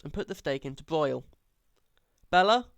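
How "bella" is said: raised, carrying call up the stairs. A narrator's reading. Father calling up the stairs to his daughter, raised voice. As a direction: call up to her from below. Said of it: "my father called out".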